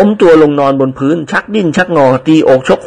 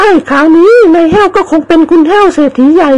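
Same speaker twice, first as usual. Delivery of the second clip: high teasing female voice; teasing lady; 3 dB louder than usual. Acted. Woman teases servant as new millionaire, high teasing voice. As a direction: tease him about being rich.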